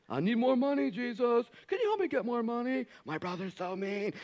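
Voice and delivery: high, whining plea; whiny asker. Retold or imitated. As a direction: beg like a petty child. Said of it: "As dumb as that question was".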